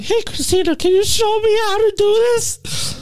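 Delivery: put-on high, sing-song old parent voice; old lady voice. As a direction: ask for help like an elderly mom. Imitating an older parent asking help.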